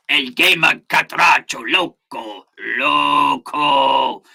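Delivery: slow, hammed-up Spanish accent; mock Spanish accent. Reading out a chatter's name, exaggerated Spanish accent. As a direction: draw out the Spanish name with relish.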